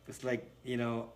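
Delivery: ordinary delivery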